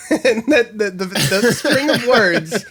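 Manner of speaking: words breaking up with real laughter; can barely get the words out for laughing. Real, not acted. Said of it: "just cracked me up".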